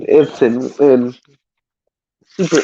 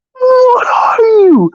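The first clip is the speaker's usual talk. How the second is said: terrified shriek; much slower than usual. Retold or imitated. Terrified goon shouts at Batman.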